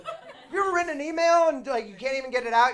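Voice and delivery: very high, loud, laughing rant; comic raving about double meaning in plays; ecstatic. Real, not acted. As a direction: gush with manic glee. Gushing over tragic layers.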